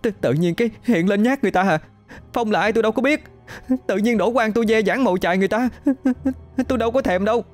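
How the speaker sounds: put-on high, fast, tearful girl's protest; blubbering cry. A narrator's reading. Hân crying at the ghost outside her door, tearful. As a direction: wail it out like a sobbing child. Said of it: "Hân òa khóc".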